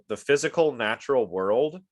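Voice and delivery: normal talking voice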